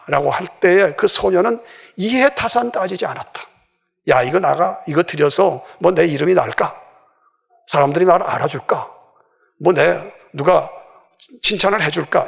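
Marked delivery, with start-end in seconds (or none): 4.1-12.3 low-pitched musing to oneself; calculating inner thoughts. Acted. Preacher voicing the self-interested thoughts the girl never had, low musing. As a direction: weigh it up privately, in a lower voice.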